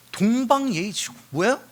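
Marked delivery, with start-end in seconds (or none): none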